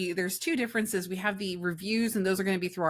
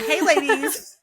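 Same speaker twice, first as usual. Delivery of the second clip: high, loud greeting through laughter; laughing retake; 8 semitones higher, 8 dB louder, slower than usual. Acted. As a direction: say it again louder and higher, laughing.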